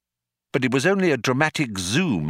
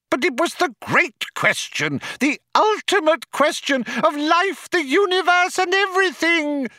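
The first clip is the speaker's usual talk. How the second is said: very high, louder anguished howl; 4 dB louder than usual. A narrator's reading. Loonquall protests about the great question, high howl. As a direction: howl it desperately, high all through. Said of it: "howled Loonquall".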